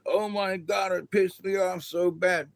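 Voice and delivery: high, whiny mock outrage; mock upset viewer. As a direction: parody someone worked up over a speech.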